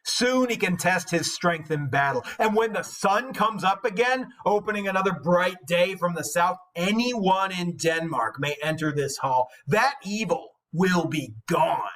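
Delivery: measured, deliberate reading of epic verse; dramatic reading of a translated epic; poem reading. Acted. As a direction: read it like an old saga, weighty and steady. Reading the Beowulf translation aloud.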